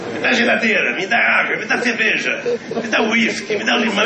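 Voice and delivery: bossy couch husband calling for things; lazy husband demanding. Retold or imitated. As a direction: order the wife around from the sofa. Husband on sofa ordering wife.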